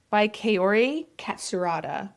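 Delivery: thick Oklahoma twang on the name; Oklahoma accent. Shown as a demonstration. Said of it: "I'm gonna say this in a very Oklahoma accent".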